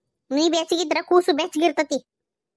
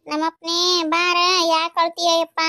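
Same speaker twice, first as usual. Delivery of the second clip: slow, drawn-out cooing; soothing baby talk. Acted. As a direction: coax a crying baby with stretched words. (ಅಳುವ ಮಗುವನ್ನು ನಿಧಾನವಾಗಿ ಮುದ್ದಿಸುತ್ತಾ)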